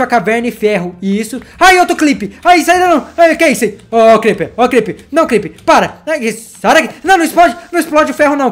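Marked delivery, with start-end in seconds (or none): 1.6-8.5 high, loud frantic begging; begging the creeper not to explode, high and frantic; panicked pleading. Real, not acted.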